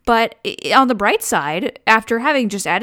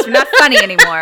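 loud, fast, through laughter; laughing mock quote; 9 dB louder, faster than usual. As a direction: quote Mom while cracking up. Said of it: "says Mom".

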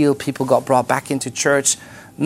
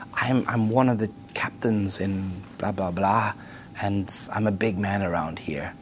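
broken confession; 8 semitones lower, 10 dB quieter than usual. Retold or imitated. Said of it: "breaks down and cries".

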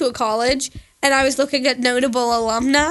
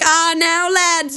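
raised-pitch put-on Irish greeting; mock a returning traveller's fake Dublin accent; 4 semitones higher, slower than usual. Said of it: "come back from Dublin".

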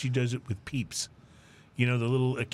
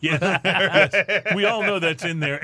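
laughing; 5 semitones higher, 7 dB louder, slower than usual. Real, not acted. Laughing at hydrogenated oils joke.

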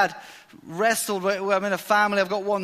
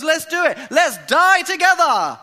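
higher and louder, keen [name]; rally the others, hammed-up keen; eager rallying cry; 9 semitones higher, 7 dB louder than usual.

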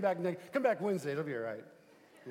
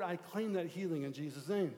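normal talking voice

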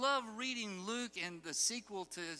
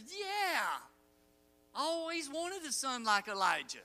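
mock response; 6 semitones higher than usual. Mock sarcastic reply.

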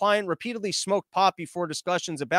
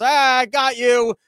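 loud, high, drawn-out taunting gotcha; mock bully gloating; 6 semitones higher, 7 dB louder, slower than usual. Agent springing the trap on losers, bully gloat. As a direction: crow it like a schoolyard bully. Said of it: "it's like bullying in school".

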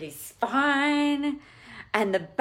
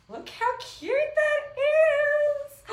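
singsong squeal; 3 dB louder, much slower than usual. Real, not acted. Finding pictures in her book.

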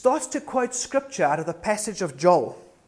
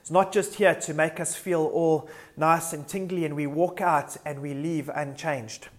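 normal talking voice

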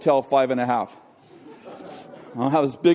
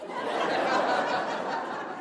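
breathless laugh; 8 semitones higher, 9 dB quieter than usual. Real, not acted. Boy under sister, laughing.

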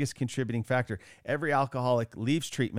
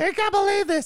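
high, loud frantic mother voice; freaking-out mom; 6 dB louder than usual. Retold or imitated. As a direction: shriek it like a panicking mom. Mom freaking out over drugs.

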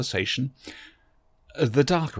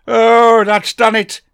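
higher and much louder exclamation; fussy Doctor alarmed; 12 semitones higher, 17 dB louder, much slower than usual. A narrator's reading. The Doctor reacts to the Dalek's spring noise, higher and much louder. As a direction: blurt it out as the Dalek starts up.

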